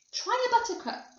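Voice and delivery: high, eager invitation; keen high-pitched cry; cried out. A narrator's reading. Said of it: "cried Mister Wonka".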